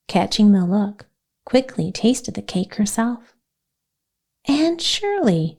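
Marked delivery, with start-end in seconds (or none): 4.5-5.6 shocked cry of Anne Shirley, high and slow; exclaiming. A narrator's reading. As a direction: call her name out in dismay, pitch shooting up. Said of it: "she exclaimed".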